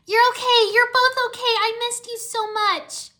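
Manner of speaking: very high, loud, gushing relief; overjoyed cry. A narrator's reading. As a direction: gush at friends found safe. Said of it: "Irene cried".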